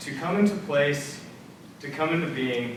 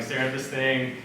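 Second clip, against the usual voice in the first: ordinary talk, nothing marked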